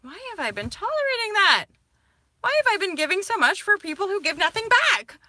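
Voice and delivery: high, loud, exasperated self-questioning; exasperated outburst. Retold or imitated. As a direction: vent the outrage at yourself. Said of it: "with me suddenly being like".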